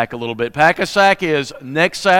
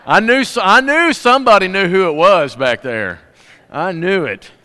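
teasing triumph; 3 semitones higher, 5 dB louder than usual. Real, not acted. Teasing the congregation.

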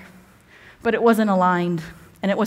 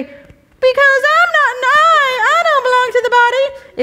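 much higher, slower, louder cartoon voice for the ear; squeaky ear voice; 7 dB louder than usual. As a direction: give the ear a silly high squeak. Ear voiced while reading scripture.

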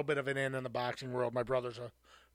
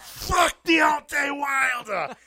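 slow, much higher, loud British shout; Tyson Fury shout; 11 semitones higher, 16 dB louder, much slower than usual. Retold or imitated. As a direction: bellow it like a fired-up boxer. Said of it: "I don't know where my accent came from".

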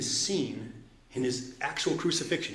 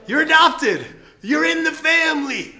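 loud, high, ringing joy; joyful exclamation; 14 semitones higher, 10 dB louder than usual. Real, not acted. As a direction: burst out in delight at the good news. Preacher exults over God's family.

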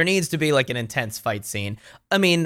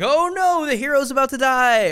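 much higher, squealing mock panic; mock alarm; 12 semitones higher than usual. Viewer watching the dragon rise behind the hero, mock high alarm. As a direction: gasp it out like a startled moviegoer. Audience reacting to dragon's fire.

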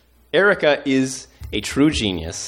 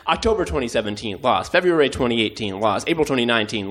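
usual speaking voice

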